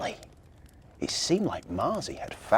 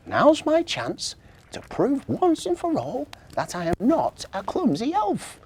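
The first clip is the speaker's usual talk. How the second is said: higher elf voice, resolved; determined little elf; 10 semitones higher than usual. A narrator's reading. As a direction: vow to prove herself as the elf. Marzi's determined thought.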